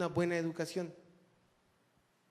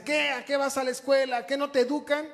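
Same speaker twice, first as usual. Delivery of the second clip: put-on high, loud scolding of a boy; father yelling; 8 semitones higher, 5 dB louder than usual. Preacher retells his father shouting at him over school. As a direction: bark it like an angry dad at his son. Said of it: "Mi papá me gritaba".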